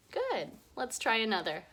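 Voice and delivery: ordinary delivery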